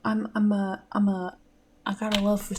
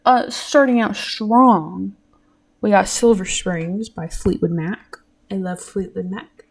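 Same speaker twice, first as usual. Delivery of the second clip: ordinary talk, nothing marked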